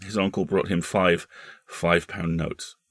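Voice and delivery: ordinary delivery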